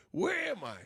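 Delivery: high baffled question; mock bewildered shopper. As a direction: sound lost and confused. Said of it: "I'm like".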